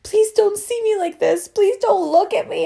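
high, drawn-out tearful plea; hammed-up whiny sob-talk; mock crying. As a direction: beg mom through imitated tears. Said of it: "I'm crying and I'm like".

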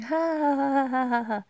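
high, loud put-on cackle; exaggerated fake laugh. Vlogger mimicking herself laughing alone, exaggerated fake laugh. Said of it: "actually laugh like that".

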